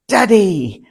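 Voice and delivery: punchline: Jesus greeting his father; joyful cry. Acted. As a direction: burst out with open-armed delight. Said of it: "opens his arms and says".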